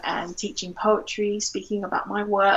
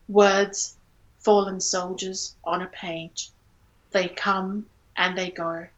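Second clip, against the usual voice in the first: poem reading. Acted. Poet reading her poem Measure to the interviewer, unhurried and measured. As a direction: let each image settle before the next.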